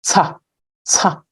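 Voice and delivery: "Ca" with a ts made at the teeth; demonstrated sound. Shown as a demonstration. Teacher showing a sound produced by the teeth, isolated "Ca". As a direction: say "Ca" as a short, separate ts syllable. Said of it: "sound that is understood to be produced by".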